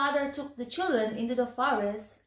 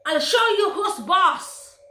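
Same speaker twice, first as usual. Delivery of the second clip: higher and louder, bossy threat; cross stepmother; 7 semitones higher, 7 dB louder than usual. Acted. Stepmother scolding the children, cross and loud. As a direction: snap it at the children like a furious stepmother. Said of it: "but stepmother was cross".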